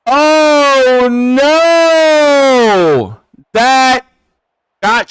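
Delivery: loud, high, long-held wail; dismayed cry. Real, not acted. Streamer reacting to a shanked kick, loud dismayed cry. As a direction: stretch it out in loud disbelief.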